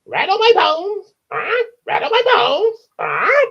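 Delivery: loud, very high parrot squawk. Acted. Parrot shows Matilda its trick, high squawky voice. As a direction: screech it like a talking bird. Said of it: "What else can he say?".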